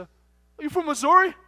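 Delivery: high, loud, drawn-out question; imitated boisterous trucker; loud trucker character. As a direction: play a brash stranger calling across the pumps. Voicing the loud Philly trucker.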